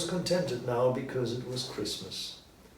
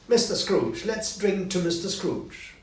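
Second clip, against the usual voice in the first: cheerful toast; 7 semitones higher, 5 dB louder than usual. A narrator's reading. Bob raising a toast.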